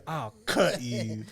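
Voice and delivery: slow, drawn-out menace; mimicking the slow threat; slow menacing threat. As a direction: drag each word like a thug.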